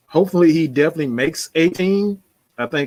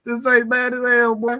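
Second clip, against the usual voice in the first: amused, post-laughter; 7 semitones higher than usual. Real, not acted. Still cracking up over rival fans.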